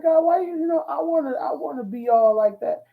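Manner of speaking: high-pitched mock plea; pleading complaint. Young preacher wanting to sound educated, pleading with God. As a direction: imitate your younger self protesting, pitch way up.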